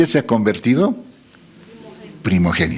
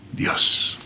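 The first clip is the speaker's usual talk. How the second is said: whispered ending; 6 dB quieter than usual. Acted. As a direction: whisper the final Dios. (Dios susurrado)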